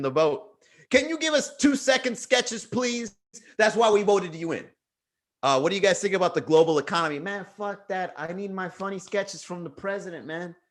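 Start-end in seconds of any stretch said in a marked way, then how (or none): 0.9-4.7 begging fan voice. Pretend. Voicing fans begging the president. 7.2-10.6 mumbling fan. Pretend. Voicing a fan, low mutter.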